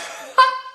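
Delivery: breathy high burst of laughter; high unvoiced laugh. Real, not acted. Seller laughs at her own product joke, a high breathy burst. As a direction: cackle at her own line.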